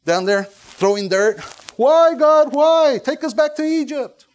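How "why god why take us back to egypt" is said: loud, high mock wail; hammed-up pleading to God, pitched way up; wailing lament. Elders on the ground cry out to God, wailing.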